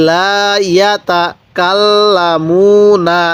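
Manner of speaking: লা ইয়াতা কাল্লামুনা drawn out very slowly, double l in কাল্লামুনা held; slow recitation. Shown as a demonstration. Teacher modelling slow Arabic recitation. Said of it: "তাহলে কি হলো?".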